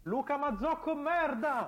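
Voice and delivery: high, loud mock despair; dramatic anguished shout. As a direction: wail the name in fake agony. Mock grief over absent colleague.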